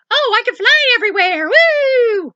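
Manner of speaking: high, loud, cackling witch. Retold or imitated. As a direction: shriek it like a gleeful witch. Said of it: "You sound like a witch".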